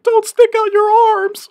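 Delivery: high, slow, squeaky mock voice; mocking friend. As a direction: mimic him in a silly high voice. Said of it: "And Brian's like".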